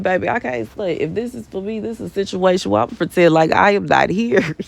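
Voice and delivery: normal talking voice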